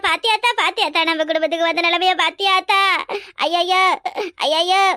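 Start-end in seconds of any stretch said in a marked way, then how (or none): none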